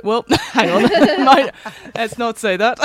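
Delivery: laughing quote. Retold or imitated. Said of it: "and I was like".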